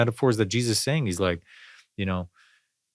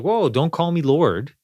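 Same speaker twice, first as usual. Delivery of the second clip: higher, slow, taken-aback Jesus pushing back; mock rebuke; 7 semitones higher, 4 dB louder, slower than usual. Jesus refusing to be called Lord, startled and slow. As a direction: pull back, startled and slowed, refusing the title. Jesus rebuffing apostles, retold.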